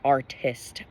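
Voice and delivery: "artist" slowly, with both Ts crisply sounded; articulated T. Shown as a demonstration. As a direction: hit each T clearly in "artist". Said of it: "articulates the T in sentences".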